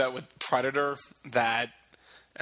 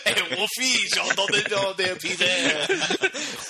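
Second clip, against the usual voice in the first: mock French voice; 6 semitones higher, 4 dB louder, slower than usual. Friends improvise a French dub for animal movies, laughing. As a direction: silly fake French, high and stretched out, laughing. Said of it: "put it in French accents".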